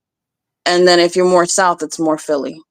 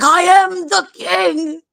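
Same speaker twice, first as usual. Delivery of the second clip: put-on much higher, slow, whiny boy-king proclamation; mocking Joffrey declaring he is king; bratty king; 11 semitones higher, slower than usual. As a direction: shriek it like a spoiled little tyrant. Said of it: "He's like".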